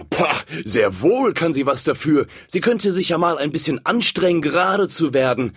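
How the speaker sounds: smug bully candle, pleased with itself; smug big candle. Acted. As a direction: sneer it down at the weakling. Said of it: "rieb sich selbstzufrieden ihren Kerzenbauch".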